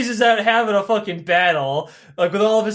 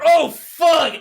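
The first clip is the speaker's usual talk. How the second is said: panicked exclamation; 7 semitones higher, 5 dB louder than usual. Acted. Sisyphus realizes gods are at door.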